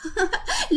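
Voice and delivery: put-on high, soft childish giggle; boy giggling at his soaked father; child's giggle. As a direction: giggle with delight. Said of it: "بيضحك بصوت عالي".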